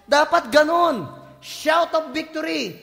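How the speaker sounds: ordinary talk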